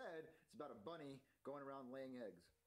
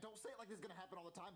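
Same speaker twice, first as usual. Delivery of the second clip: usual speaking voice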